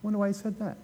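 the crowd quietly puzzled by Jesus; puzzled muttering. Acted. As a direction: mutter it to yourself, suspicious. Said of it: "they're like".

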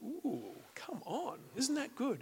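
hushed, high-pitched "ooh" of delight; softly marvel, pitch lifting; delighted reaction. Retold or imitated. Preacher reliving his discovery in the Voice Bible, quietly delighted. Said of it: "I was like".

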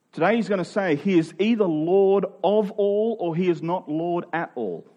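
normal talking voice

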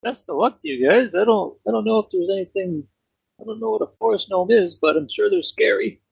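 put-on high Canadian accent, wishing guests luck; mock Canadian accent. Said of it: "And he had an accent".

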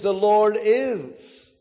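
"is" stretched long with a falling tune; emphasis on IS. Shown as a demonstration. Preacher stressing that God exists, long weighty "is". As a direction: land heavily on "is" and hold it. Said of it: "we could place the emphasis on the word is".

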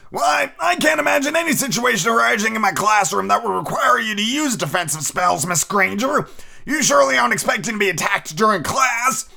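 high, sugary-sweet teacher voice looking down on the pupil; a falsely polite schoolmistress, pitched well up; Umbridge voice. Acted. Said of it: "doing Umbridge's voice".

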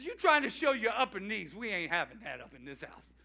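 ordinary talk, nothing marked

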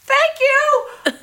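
very high, bright chirp of thanks; high chirpy thanks. Retold or imitated. Dancer thanking the panel, high and chirpy. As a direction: chirp it out delighted.

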